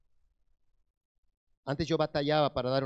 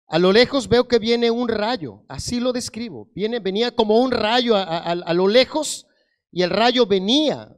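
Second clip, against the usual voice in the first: loud, raised-pitch testimony preaching; impassioned preaching; 5 semitones higher, 9 dB louder than usual. Real, not acted. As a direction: preach the vision with urgent, sustained force. Preaches a vision with rising force.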